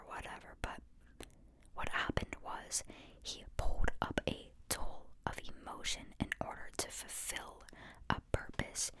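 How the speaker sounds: ordinary delivery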